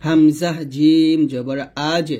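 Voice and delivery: slow spelling-out, 'আজ' ending in a bounce; qalqala on jim. Shown as a demonstration. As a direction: bounce the final consonant of 'আজ'. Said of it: "তাই কলকলা করতে হবে". ('আজ' কলকলা করে ধীরে উচ্চারণ)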